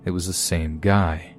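plain reading voice

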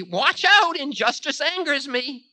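high, angry warning starting loud; sharp warning. A narrator's reading. Time angered by injustice warning Ravana, sharp. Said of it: "said Time sharply".

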